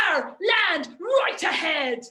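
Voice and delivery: slow, drawn-out pirate call; pirate lookout. Acted. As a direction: call out like a pirate spotting shore. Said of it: "when you're a pirate".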